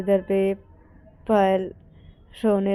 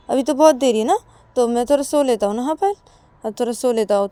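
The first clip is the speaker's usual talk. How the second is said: raised, louder voice, defensive; protesting excuse; 6 semitones higher, 8 dB louder than usual. Acted. Partner lying in bed puts off office, protesting excuse. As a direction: argue back for a little more sleep. Ananya defends wanting more sleep.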